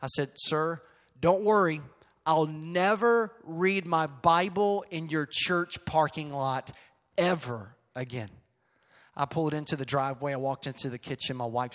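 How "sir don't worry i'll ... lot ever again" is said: louder, drawn-out mock reassurance; slow it right down, stretching NEVER and EVER with sarcasm; mildly sarcastic. Minister answering the church staffer who asked him to leave, sarcastic. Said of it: "a little bit of sarcasm".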